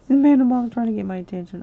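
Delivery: fast, loud excited aside. Real, not acted. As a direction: rattle it off laughing. Laughing report to chat.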